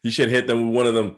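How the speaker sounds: ordinary delivery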